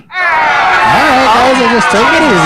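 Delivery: calling out. Acted. Calming the imaginary drumline.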